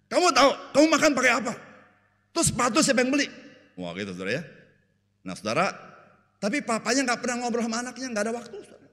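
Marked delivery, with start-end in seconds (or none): 0.1-3.3 high, loud indignant dad; defensive father. Acted. Preacher plays a busy dad scolding his child, loud and high. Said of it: "Papa bilang gini". 6.4-8.9 high, mock-incredulous aside. Preacher mocks the father who never talks to his son, high.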